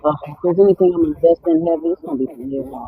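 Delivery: muffled through earphones; muffled earphone mic. Real, not acted. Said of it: "You definitely muffled".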